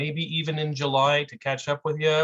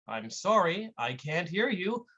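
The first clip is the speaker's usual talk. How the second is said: ordinary talk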